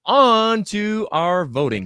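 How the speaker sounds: high, drawn-out segue; stretched-out host's announcement; mock announcer. Moderator moves show along.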